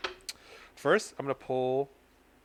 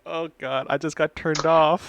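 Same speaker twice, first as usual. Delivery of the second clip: mock outrage; 3 semitones higher, 9 dB louder than usual. Mock disgust after a joke.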